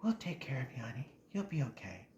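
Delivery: soft, hushed mother's comfort; hushed motherly reassurance after the mess; soothing mom. Retold or imitated. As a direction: murmur gently like a mom calming her kid. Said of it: "my mom was like".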